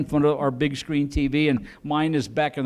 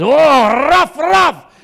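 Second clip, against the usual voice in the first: loud, high barking yelps in a Scottish voice; hammed-up Scottish barking dog; 10 semitones higher, 9 dB louder, much slower than usual. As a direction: bark like a dog from Scotland. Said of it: "he even barks in Scottish".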